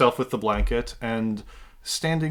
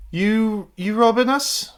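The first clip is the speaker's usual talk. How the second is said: put-on higher, louder stab at an Irish accent; attempted Irish accent; 7 semitones higher, 4 dB louder, slower than usual. Game master voicing a man asking the intruders, attempted Irish accent. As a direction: ask it in a faltering Irish accent. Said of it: "why can't I do a fucking Irish accent".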